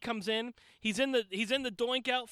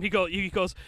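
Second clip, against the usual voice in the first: normal talking voice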